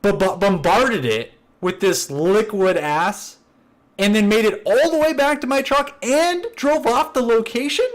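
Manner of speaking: acted-out disbelief, rising as it goes; incredulous protest. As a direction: argue how absurd the accusation is, climbing in pitch. Acted argument, protesting he couldn't have.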